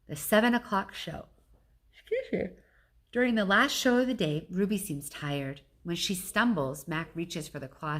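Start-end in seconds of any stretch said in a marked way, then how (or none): none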